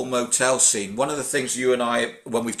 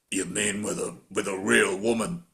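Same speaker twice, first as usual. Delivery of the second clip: lower, softer mock Stallone line; imitating Stallone; 4 semitones lower, 5 dB quieter than usual. Podcaster quoting Stallone's line to Bridget, lower mock voice. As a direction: drop into a low, mumbled tough-guy read. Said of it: "Stallone actually goes".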